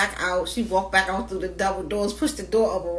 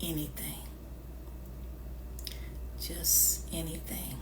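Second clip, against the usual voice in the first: whispered; 5 semitones lower, 15 dB quieter than usual. Real, not acted. Stressing low standards.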